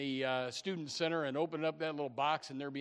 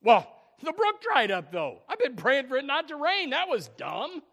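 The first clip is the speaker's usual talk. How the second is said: doubting complaint; 11 semitones higher, 13 dB louder than usual. Acted. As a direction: protest as if the plan was foolish. Acts Elijah's doubting complaint.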